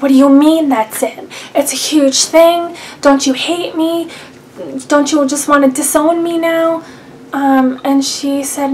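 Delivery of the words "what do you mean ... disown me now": higher, louder tearful pleading; upset, tearful plea. Retold or imitated. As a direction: beg through tears, frightened. Upset reply to calm mom.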